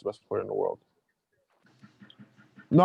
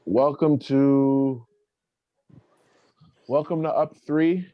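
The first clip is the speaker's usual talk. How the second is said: show host intro; 3 dB louder, much slower than usual. Acted. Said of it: "restarting the show intro".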